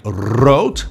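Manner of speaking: "rood" with a rolled R at the start; "rood" in Dutch, R rolled. Shown as a demonstration. Teacher showing the Dutch word for red, rolled R. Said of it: "rolling R is only at the beginning".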